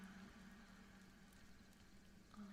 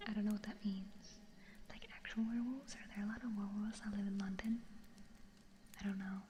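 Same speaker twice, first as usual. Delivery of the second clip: whisper; 11 dB louder than usual. Real, not acted. Whispering to stream viewers, puzzled whisper. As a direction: breathe the words, no voice.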